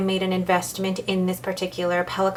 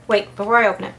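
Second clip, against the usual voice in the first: usual speaking voice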